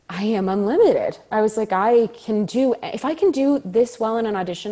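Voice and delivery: loud, lifted triumphant declaration; retold burst of self-belief; elated realization. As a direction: proclaim it with rising confidence. Retells elation after great auditions.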